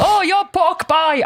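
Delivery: high, drawn-out, hammed-up attempt at an accent; botched accent impression, stretched and high-pitched; badly attempted TV accent. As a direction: call it out loud and high in a badly faked accent. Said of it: "I can't do the accent".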